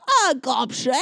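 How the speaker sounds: a hammed-up fake Cockney accent; bad fake Cockney, gobshite; mock Cockney. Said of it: "kind of fake Cockney accent".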